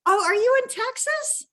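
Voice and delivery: high, delighted surprise; a real spike of surprise at the news; surprised. Real, not acted. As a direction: pitch jumps way up in surprise. Surprised to learn location.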